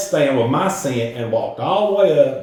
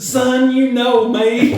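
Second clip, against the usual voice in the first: laughing Dad voice; 10 semitones higher, 5 dB louder, much slower than usual. Retold or imitated. Dad's sheepish reply voiced.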